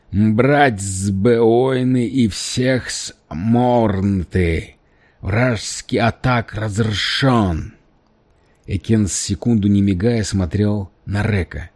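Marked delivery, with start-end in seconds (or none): 0.1-7.7 slow, heavy alien commander; alien warrior voice. Acted. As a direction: spell out the orders slowly like an alien. The alien warrior gives orders. (медленный тяжёлый голос пришельца)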